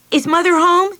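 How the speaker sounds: high, loud eager call; excited cry. Acted. Meg rushes home calling for Mother, excited. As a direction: burst in calling for mum. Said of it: "she cried".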